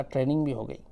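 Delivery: ordinary delivery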